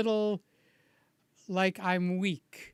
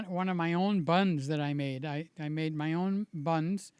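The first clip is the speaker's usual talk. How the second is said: normal talking voice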